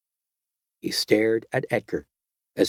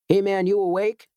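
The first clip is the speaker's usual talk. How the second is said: put-on raised young voice; 8 semitones higher than usual. A narrator's reading. Cellmate wakes him up.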